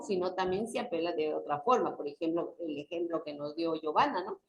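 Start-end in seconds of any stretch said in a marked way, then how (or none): none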